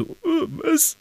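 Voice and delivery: high, squeaky double-take; startled. A narrator's reading. Ron hearing his teacher is a werewolf, startled. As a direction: jump up in pitch, dumbfounded. Friend shocked by werewolf confession.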